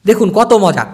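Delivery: normal talking voice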